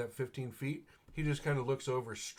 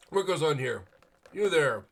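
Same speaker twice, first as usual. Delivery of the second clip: loud, higher guard's demand; stern guard; 4 semitones higher, 9 dB louder than usual. Pretend. Guard halting the knight in the market, loud demand. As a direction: bellow it like a watchman.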